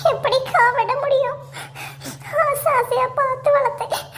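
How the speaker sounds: high-pitched, put-on crying lament; tearful wailing. Gita laments her lost plant to a consoling friend, tearful wailing. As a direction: wail it like an overdone sob story. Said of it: "சரி அழாத கீதா".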